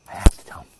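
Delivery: loud stage whisper; whispered urge. Real, not acted. Insisting on telling the secret, stage whisper. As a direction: whisper it urgently. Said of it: "Don't tell anybody".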